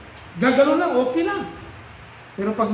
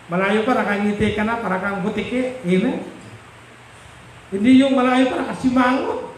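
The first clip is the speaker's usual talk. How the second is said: usual speaking voice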